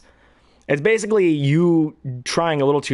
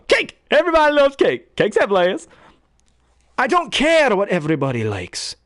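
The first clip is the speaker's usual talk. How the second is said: high, exclaiming animated-film voice; cartoon character bit; 9 semitones higher than usual. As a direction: put on a big cartoon character voice. Cartoon voice demo.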